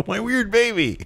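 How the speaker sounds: high, silly mock protest; whiny wrestler. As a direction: wail it in a high put-on voice. Continues the mocking wrestler voice.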